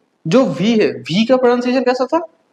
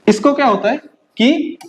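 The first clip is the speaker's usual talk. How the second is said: ordinary talk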